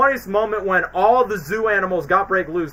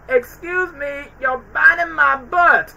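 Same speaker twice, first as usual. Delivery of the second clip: higher, slow polite protest; mock prim complaint; 8 semitones higher, slower than usual. Marty complains to Alex, mock prim. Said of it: "he goes".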